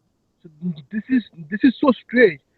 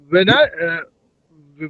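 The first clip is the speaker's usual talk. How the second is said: ordinary talk, nothing marked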